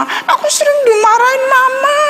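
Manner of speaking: put-on high, whiny little child voice; complaining child. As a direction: voice a hurt little kid tattling on mom. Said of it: "katanya gitu".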